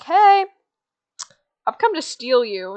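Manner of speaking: loud, high retold hey; mock confrontational shout. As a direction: call out high to announce yourself. Goldilocks confronts them, calling out.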